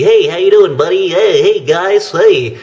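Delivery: high, loud, overly cheery mock greeting; howdy doody voice. Vlogger mocking a cheesy YouTuber, in a high hammed-up howdy doody voice. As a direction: ham up a corny, too-nice old host. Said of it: "like a howdy doody".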